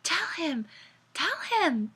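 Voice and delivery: high, soft, hushed urging; eerie whispered command. A narrator's reading. Mysterious park voice prompting Mariana, whispering. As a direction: urge it softly from out of nowhere. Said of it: "the same voice she had heard in the park whisper".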